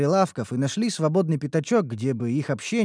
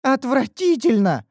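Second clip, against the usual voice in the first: put-on high loud indignant elf girl; young elf girl refusing to go back; declaring; 10 semitones higher, 6 dB louder than usual. A narrator's reading. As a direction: stamp her foot in protest. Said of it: "заявила Неуру".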